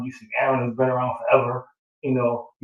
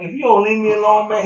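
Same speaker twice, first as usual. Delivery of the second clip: retold warning, higher and louder; mock annoyed warning; 8 semitones higher, 8 dB louder than usual. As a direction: act out a fed-up "back off", raised voice.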